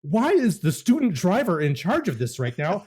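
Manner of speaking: high-pitched mock-panicked complaining; panicked passenger protest. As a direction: protest like a passenger who wants out. Retold protest from the back seat.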